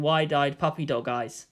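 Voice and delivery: plain reading voice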